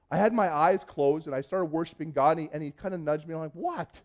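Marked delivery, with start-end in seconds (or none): 3.5-4.0 annoyed surprise. Retold or imitated. Nudged during worship, retold reply.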